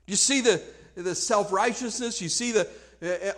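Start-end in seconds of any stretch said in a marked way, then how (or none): none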